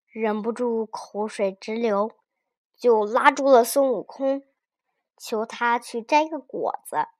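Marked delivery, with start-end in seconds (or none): none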